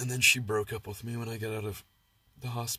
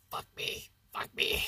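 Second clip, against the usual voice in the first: imitating girlfriend. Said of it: "Doctor Jekyll and Mister Hyde".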